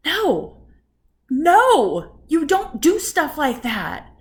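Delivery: emphatic, high, drawn-out disapproval; scolding. Real, not acted. Woman objecting to a rude man's staring, scolding. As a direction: lay down the law, loud and slow.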